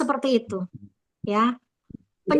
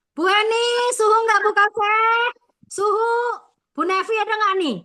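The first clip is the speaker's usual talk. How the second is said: higher-pitched roll-call shout-out; memanggil absen; 6 semitones higher than usual. Real, not acted. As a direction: call out names to a group, pitched up. Calling the roll of team members. (memanggil nama dengan nada tinggi)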